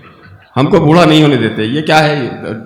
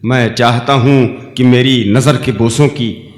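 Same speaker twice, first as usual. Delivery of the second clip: low, measured nazm reading; stately nazm recitation; 4 semitones lower than usual. Acted.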